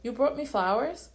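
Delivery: bright lift of delighted surprise; pleased surprise. A narrator's reading. Surprised to find him holding flowers, pleased. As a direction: caught off guard by a gift, pleased. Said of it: "so I smiled".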